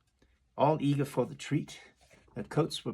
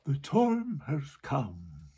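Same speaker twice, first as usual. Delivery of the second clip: very slow, grave walrus voice; much slower than usual. Acted. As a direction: announce it slowly and weightily. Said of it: "the walrus said".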